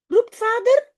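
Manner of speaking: lively read-aloud voice with little character voices; story narration. Acted. Teacher reading a Dutch story to her students, lively read-aloud. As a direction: read it like a bedtime story for kids.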